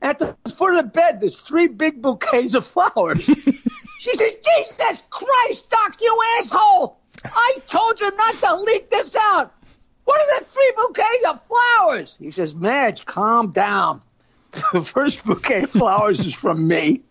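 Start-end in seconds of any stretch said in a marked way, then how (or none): 4.4-12.2 put-on high, shrill old woman's squawk; a furious patient, pitched way up; shrill Madge. Patient Madge yelling at doctor. 12.5-17.0 lower, soothing doctor; calm doctor. Acted. Doctor answers Madge.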